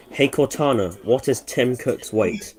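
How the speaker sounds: talking to voice assistant. Real, not acted. Slow query to Cortana.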